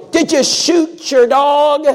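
high, slow, loud gruff demand; grouchy neighbor. Retold or imitated. Grouchy neighbor questioning the preacher, slow and loud. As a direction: bark it slowly like a suspicious neighbor.

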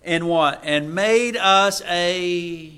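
loud, slow scripture proclamation with fervent asides; preaching at full force. Acted. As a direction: proclaim each phrase to the church. Reading Revelation with fervent asides.

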